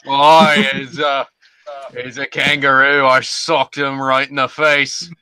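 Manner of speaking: high, loud, slow Aussie bloke; Australian accent. Retold or imitated. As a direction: lay on a broad Australian accent. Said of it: "That was like Australian".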